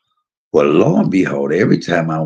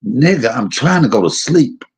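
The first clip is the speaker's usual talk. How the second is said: higher-pitched mock annoyance; exasperated retelling, pitched up; retold irritated outburst; 8 semitones higher than usual. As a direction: tell off a noisy cellmate, half in earnest. Retold telling cellmate to shut up.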